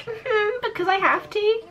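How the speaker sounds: pitched well up, mock-innocent imitation; mimicked offhand reply. Blogger answering the new kid, high mimicked reply. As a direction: imitate her in a high little voice.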